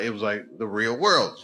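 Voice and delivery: usual speaking voice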